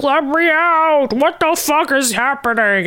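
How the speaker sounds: hammed-up Apocalypse beating on the lid; mock trapped villain yell. Recapping Apocalypse sealed in his tomb, mock panicked yell.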